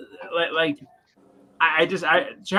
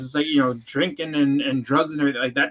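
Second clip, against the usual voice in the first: normal talking voice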